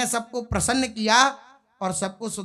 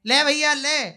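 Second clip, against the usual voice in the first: calling out; 5 semitones higher, 5 dB louder than usual. Acted. Krishna serving friends loudly.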